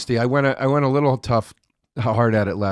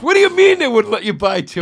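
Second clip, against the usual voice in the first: loud, high mock disbelief; retold indignation; 9 semitones higher, 6 dB louder than usual. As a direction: imitate an indignant friend, raised and pushed. Retold angry friend questions him.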